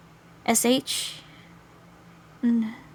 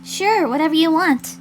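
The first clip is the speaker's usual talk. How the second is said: higher-pitched, offhand agreement; lightly voiced girl; 8 semitones higher, 4 dB louder than usual. Girl replying to the music offer, higher character voice. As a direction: answer in a raised character voice.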